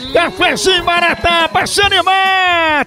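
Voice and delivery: upbeat radio commercial voice; ad read. Acted. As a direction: sell the coffee with energy. Live coffee ad read. (locução de comercial animada)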